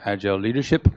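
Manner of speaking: usual speaking voice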